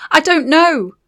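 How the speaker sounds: high, loud desperate cry. Acted. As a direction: cry it out, cornered. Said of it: "she cried".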